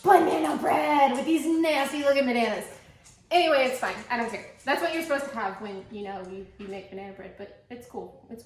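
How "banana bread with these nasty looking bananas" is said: grand reveal. Pretend. Big reveal after drumroll.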